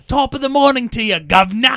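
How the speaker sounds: shouted, high caricature British greeting; mock British accent. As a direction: belt it out as a stage Brit. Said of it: "it's British".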